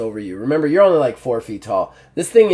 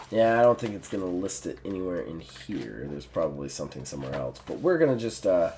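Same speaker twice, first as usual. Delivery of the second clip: usual speaking voice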